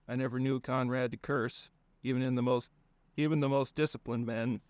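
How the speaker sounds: ordinary delivery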